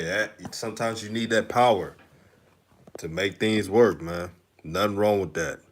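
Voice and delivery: ordinary talk, nothing marked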